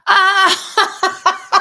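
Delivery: sudden loud laughing outburst, high up; a real cackle as the joke finally lands; loud startled laugh at the pun; burst of real laughter. Real, not acted. Finally gets the welcome mat joke.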